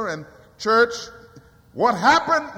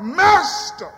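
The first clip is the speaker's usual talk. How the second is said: 1 crying out; 8 semitones higher, 3 dB louder than usual. Retold or imitated. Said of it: "I prayed it".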